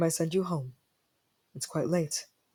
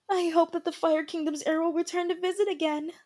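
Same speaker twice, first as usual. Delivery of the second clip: dreamy gossiping maid; 12 semitones higher than usual. Acted. Gossiping maids watching.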